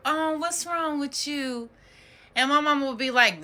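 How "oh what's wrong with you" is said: higher, drawn-out mock-sympathetic coo; cooing concern. Relative asking a child in trouble, put-on cooing concern. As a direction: imitate a relative fussing over a child, slowly.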